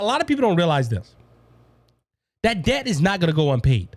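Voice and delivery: ordinary delivery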